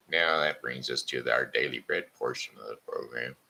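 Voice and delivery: normal talking voice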